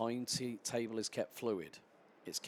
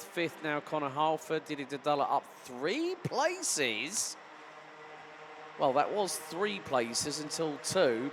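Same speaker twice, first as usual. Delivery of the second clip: live play-by-play; 3 semitones higher, 6 dB louder than usual. Real, not acted. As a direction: call every overtake with urgency.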